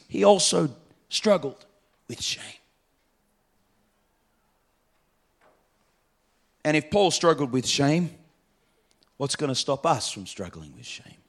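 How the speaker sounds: sudden drop to a soft, low hush. Real, not acted. Preacher to congregation, dropping to a near hush. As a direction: pull the room in close and quiet.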